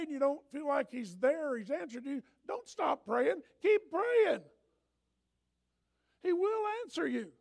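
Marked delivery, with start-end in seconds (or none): 2.5-7.3 higher and louder shout; shouted exhortation. Real, not acted. Pastor shouts at the church not to quit praying. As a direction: cry it out to the flock.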